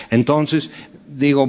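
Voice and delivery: ordinary delivery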